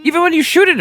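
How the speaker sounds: indignant. Real, not acted. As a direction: fling it out in disbelief. Indignant rant about cowardly monster.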